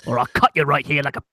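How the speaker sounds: low, fast gangster threat; menacing low voice. Acted. A child threatens over the earrings.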